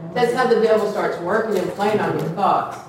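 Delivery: ordinary talk, nothing marked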